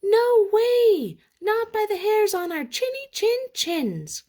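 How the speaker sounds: high, loud piggy shout; three pigs shouting at the wolf; defiant cry. Acted. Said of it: "cried the three little pigs".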